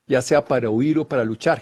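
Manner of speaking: ordinary talk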